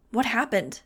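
a touch of panic; alarmed cry. A narrator's reading. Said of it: "Iggy cried".

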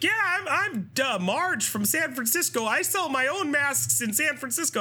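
high, sing-song saleslady; chirpy saleswoman. Retold or imitated. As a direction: introduce yourself like a perky mask seller. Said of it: "one lady who's like".

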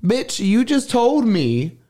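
high, slow outraged comeback; drawn-out indignant high voice; indignant retort. Retold or imitated. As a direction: throw it back at her in disbelief. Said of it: "and I was like".

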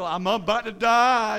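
loud, drawn-out mock wail; dramatic despair. David at his lowest in the grave, hammed-up despairing cry. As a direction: cry it out slowly, hammed-up doom. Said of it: "I mean, he's either".

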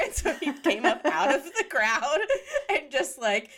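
giggling through the words; laughing as she tells it; breaking into laughter mid-story. Real, not acted. Laughing while telling.

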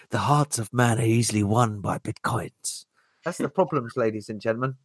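a hint of a solemn sermon; mock preacher. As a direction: preach to the folks, half in jest. Mock sermon to ladies and gentlemen.

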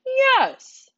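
shrill burst of triumph; excited yes. A narrator's reading. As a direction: burst out with delight. Bart excited, waving dog.

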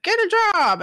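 high-pitched mock parental order; nagging dad. Dad telling the teenager to work, mock nagging. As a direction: imitate a dad on your case.

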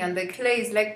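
normal talking voice

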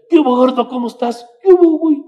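high, loud friendly greeting; friendly call. Retold or imitated. Friends greeting the chubby boy, loud and high.

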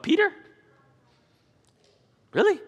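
can't believe it was Peter; incredulous. Real, not acted. Preacher marveling to the congregation that Peter walked on water, incredulous.

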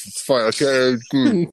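muffled behind a hand; hand over mouth. Real, not acted. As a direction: talk through fingers over mouth. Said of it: "I'm gonna put my hand over my mouth".

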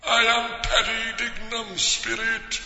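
drawn-out ghostly calling. Acted. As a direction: call like a ghost. Ghost speaking.

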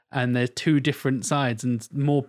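ordinary talk, nothing marked

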